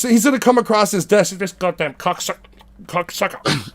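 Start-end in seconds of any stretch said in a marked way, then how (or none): 1.4-3.7 put-on quieter grumbling British accent. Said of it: "that same accent".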